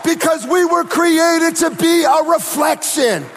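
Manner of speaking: building, rising, driving sermon cadence; preaching at full force. Acted. Urging the church to take on identity, building to shouted preaching.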